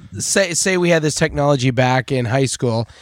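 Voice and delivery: ordinary talk, nothing marked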